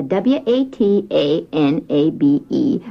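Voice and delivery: W A T A N A B E, each letter said separately; spelled out letter by letter. Shown as a demonstration. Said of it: "That's a".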